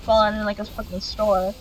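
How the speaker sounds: usual speaking voice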